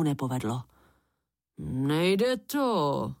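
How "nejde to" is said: grumbling. A narrator's reading. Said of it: "zabručel nakonec".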